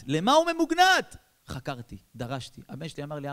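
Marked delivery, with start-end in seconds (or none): 0.1-1.2 much higher, louder baffled shout; baffled outcry. Retold or imitated. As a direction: cry it out in disbelief. Father puzzled by son's zeal. (שאלה נדהמת בצעקה גבוהה)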